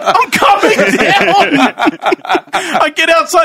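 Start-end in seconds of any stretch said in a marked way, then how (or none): 0.0-1.6 high, drawn-out reply breaking into laughter; mock-exasperated call back, cracking up; laughing retort. Customer calling back to the pushy driver, laughing retort.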